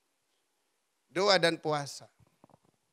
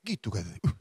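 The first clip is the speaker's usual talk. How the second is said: usual speaking voice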